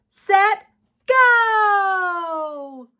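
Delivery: big bright shout to kick off; excited shout. Real, not acted. Teacher to kids, kicking off story time, excited shout. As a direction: shout it to launch the fun. Energetic countdown start.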